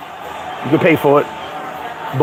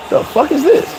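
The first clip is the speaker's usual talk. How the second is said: mock incredulous; 8 semitones higher than usual. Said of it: "laughing at you going".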